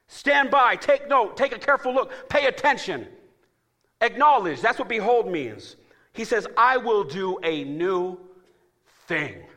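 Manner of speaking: high, loud, slowed preaching cadence; full-force preaching. Acted. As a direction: preach it out to the church.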